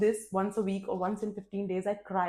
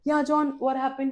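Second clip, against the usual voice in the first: raised, louder voice holding back tears; almost in tears; 6 semitones higher, 5 dB louder than usual. Retold or imitated. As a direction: re-enact answering while close to crying. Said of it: "I was almost in tears".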